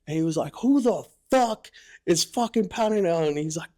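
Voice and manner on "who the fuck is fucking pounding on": high, loud barked yell of a woken drill instructor; angry drill instructor. Retold or imitated. Drill instructor woken by pounding on his hatch, furious yell. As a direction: bark it like a furious DI.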